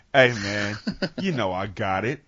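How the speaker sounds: low-pitched cool nickname catchphrase. Acted. Robinson using his nickname on everybody, deep voice. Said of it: "Like his voice got deeper".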